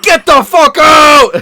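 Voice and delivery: high, full-volume panicked yell; retold outburst at the top of the voice; freaked-out shout. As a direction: re-enact screaming at an intruder, drawn out. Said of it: "I started freaking out".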